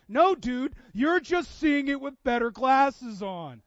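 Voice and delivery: loud, slow, pointed correction; slow, emphatic "dude" retort; knowing reply. Retold or imitated. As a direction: set your friend straight. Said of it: "You're like".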